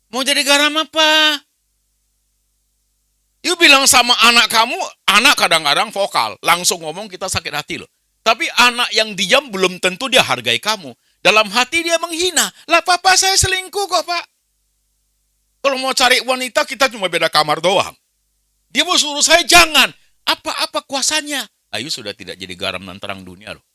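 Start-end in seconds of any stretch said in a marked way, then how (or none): 0.1-12.5 loud, high, fiery rebuke from the pulpit; preaching at full force. Acted. Preacher confronts parents in the congregation with a loud, fiery rebuke. 12.7-21.4 put-on loud, high, indignant young man, preacher-style; quoting the young man. Young man complains to the pastor about his cheating father, loud and indignant.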